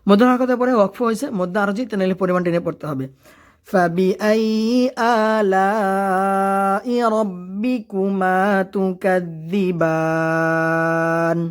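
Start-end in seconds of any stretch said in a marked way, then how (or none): 3.7-11.4 slow, melodic tajweed chant with long held vowels; Quran recitation. Acted. Teacher reciting the verse to his students in a slow melodic chant. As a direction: chant each word out slowly like a qari.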